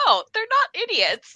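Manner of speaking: high, loud protesting gasp of realization; mock exclamation. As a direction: blurt it out like a surprised reader. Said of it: "I'm like".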